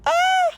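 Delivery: sudden high piercing scream; a startled woman's high shriek; scream of fright. Acted. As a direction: shriek as the shot goes off. Gunshot fired near mother.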